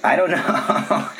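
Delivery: chuckling through the words; laughing. Real, not acted. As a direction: laugh while answering. Laughs at question about Astana.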